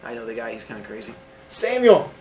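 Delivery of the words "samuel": high, loud call; raised, musical name call. Real, not acted. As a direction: call the student's name out sharply. Teacher calls out to student.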